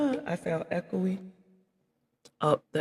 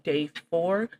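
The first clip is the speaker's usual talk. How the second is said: upbeat host introduction, slow and loud; announcer intro; 4 dB louder, slower than usual. Real, not acted. Formal course introduction.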